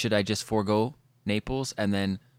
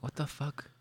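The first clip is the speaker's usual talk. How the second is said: awed whisper; 3 semitones higher, 12 dB quieter than usual. Retold or imitated. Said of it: "I'm like".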